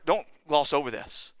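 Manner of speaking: usual speaking voice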